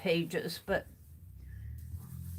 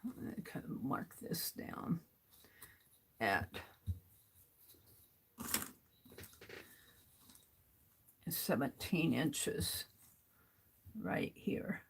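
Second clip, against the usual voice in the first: whispering; 5 semitones lower, 14 dB quieter than usual. Real, not acted. Quiet focused marking.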